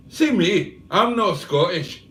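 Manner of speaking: put-on Scottish accent; mock Scottish voice. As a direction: read it out as a protesting Scot. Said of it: "So why do you sound Scottish".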